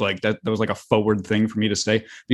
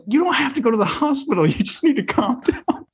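put-on girl laughing at him; 13 semitones higher than usual. Girl voiced calming him, laughing.

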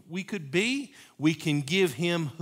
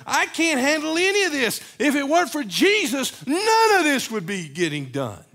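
loud, high, impassioned preaching; full-force preaching; 11 semitones higher, 9 dB louder than usual. Acted. Preacher pleading loudly with the church. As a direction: cry it out to the congregation, much higher.

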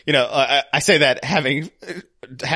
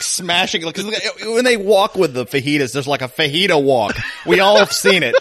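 usual speaking voice

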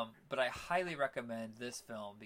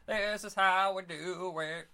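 higher, louder sing-song boast; excited catchphrase; 6 semitones higher, 6 dB louder than usual. Real, not acted. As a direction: belt it out like a hype man. Sign-off catchphrase.

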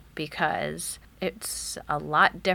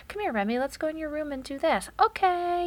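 put-on higher, gentle invitation to a child; coaxing a toddler; 10 semitones higher than usual. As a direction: lure the little one away kindly. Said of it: "And they'll say".

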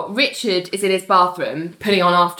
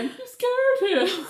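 high, slow comic whine, a little softer; mimicked wounded complaint; 13 semitones higher, 5 dB quieter, slower than usual. Cohost relives Richard's line for laughs, high wounded mimicry. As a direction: imitate him, high and drawn out. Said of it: "It's just the way he was like".

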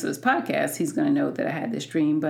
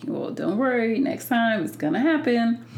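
a light, higher-pitched take on the husband's cheerful reassurance; imitating upbeat husband; 7 semitones higher than usual. Wife mimics husband's usual pep talk, pitched up and cheerful. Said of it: "he's always the person who's like".

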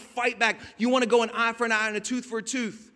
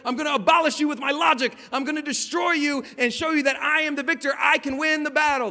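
smug gloating; 6 semitones higher, 6 dB louder than usual. Retold or imitated. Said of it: "we're like".

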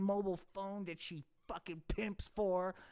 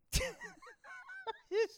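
a squeaky high laugh at his own bit; high giggle. Real, not acted. Laughing at his own Ken voice, high giggle.

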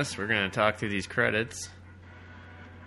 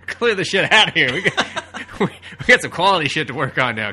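loud, higher shout through laughter; laughing mock shout; 7 semitones higher, 9 dB louder than usual. Riffing on a credit title, laughing mock shout. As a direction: bellow it like a boss clearing the room, laughing.

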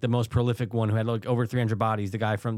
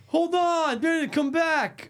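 calling out; much slower than usual. Real, not acted. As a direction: call across the room. Calls to someone off-mic.

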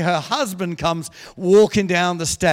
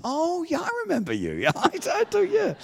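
sudden recognition; 12 semitones higher than usual. Retold or imitated. Preacher recognising the couple who threw him out, exaggerated realisation. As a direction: act out suddenly remembering someone.

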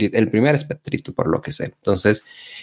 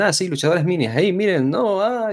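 high, careless mock announcement; dismissive promoter voice; 9 semitones higher than usual. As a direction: toss it off like nobody cares. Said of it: "y dicen".

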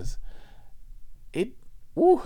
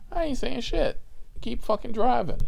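high, loud insistence; emphatic exclamation; 12 semitones higher, 4 dB louder than usual. Real, not acted. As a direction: blurt it out, higher and louder. Resolving to stay silent.

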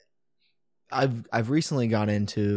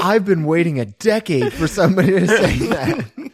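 laughing mock gratitude; 6 semitones higher, 8 dB louder than usual. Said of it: "You're like".

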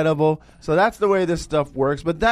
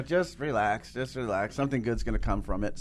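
hushed, softer, calming himself down; a soft reassuring inner voice, much softer; soothing self-talk; 7 semitones lower, 9 dB quieter than usual. Retold or imitated. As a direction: talk yourself down quietly, gently.

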